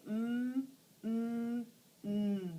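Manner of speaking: mm hummed with lips closed; long closed-lip mm; M sound. Shown as a demonstration. Teacher showing children the M sound with lips closed. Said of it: "make the M sound".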